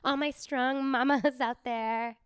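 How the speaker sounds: reading mom captions. Acted. Reading captions to all the mamas out there, gushy influencer voice. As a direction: gush encouragement like an Instagram mom. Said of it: "is that how you read the captions?".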